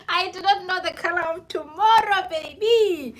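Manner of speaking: high, loud hammed-up wail; mock crying. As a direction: wail it like fake sobbing. Repeats the line, mock crying.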